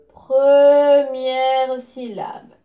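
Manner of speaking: "première syllabe" loud and very slow; drawn-out emphasis. Shown as a demonstration. Teacher gives pupils the answer, slowly stretched out. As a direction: lean long and loud on "première".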